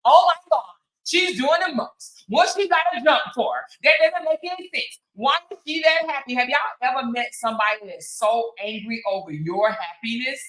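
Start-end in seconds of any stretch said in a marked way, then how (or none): 0.0-6.3 high, loud gossipy mock outrage; mocking haters. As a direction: imitate a catty hater, higher and louder.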